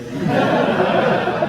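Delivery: high breathy unvoiced laugh; real laugh. Real, not acted. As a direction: burst out laughing at the tiny bed. Laughing at child air bed.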